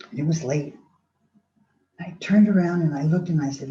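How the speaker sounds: ordinary talk, nothing marked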